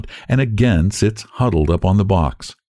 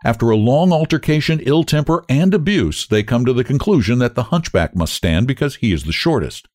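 ordinary delivery

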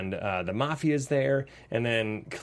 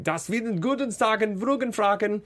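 mock German accent, pitched up; 7 semitones higher than usual. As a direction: fake gibberish German. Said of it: "a German accent".

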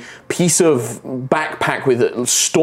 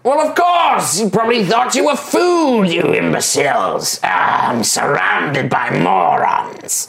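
condescending goblin; 4 semitones higher than usual. Acted. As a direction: scold them as fools. Goblin berates the party.

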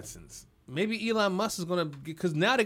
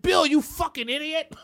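high-pitched comic scolding; imitated exasperated boss; mock office insult; 8 semitones higher, 3 dB louder than usual. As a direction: berate a colleague, playfully overdone. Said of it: "They're like".

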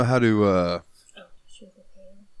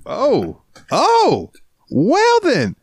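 mock shock; much slower than usual. Mock offended reaction.

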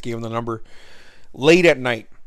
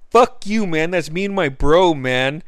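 higher and louder retort; playful mock yell; mock angry comeback; 5 semitones higher, 5 dB louder than usual. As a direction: shout back at the guy, half joking. Snapping back at the heckler.

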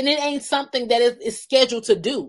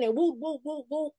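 normal talking voice

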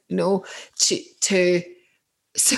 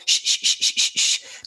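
put-on mother shushing baby. Mother soothing her crying baby, whispered shush. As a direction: hush a crying baby gently. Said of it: "The mother's like".

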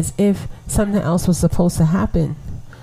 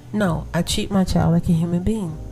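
ordinary talk

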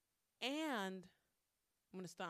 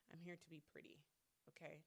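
soft dismissive; 10 dB quieter than usual. Retold or imitated. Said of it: "It's like".

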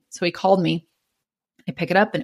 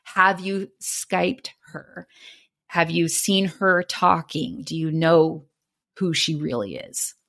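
drawn-out, measured questions; pointed questioning; slower than usual. Retold or imitated. Pressing her on who Kimmy really is, slow and pointed. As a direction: let each question sink in slowly.

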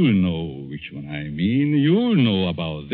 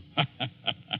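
soft evil chuckle; 3 semitones higher, 10 dB quieter than usual. Acted. Villain laughs after naming victim.

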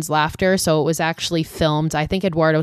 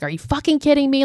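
retold yelling; 9 semitones higher, faster than usual. Josh and Heather turn on Mike over the map, mock yelling. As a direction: yell it in pitch rather than volume. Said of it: "start yelling".

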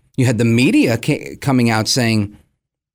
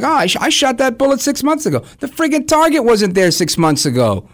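mock sniper; 9 semitones higher than usual. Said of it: "you're like".

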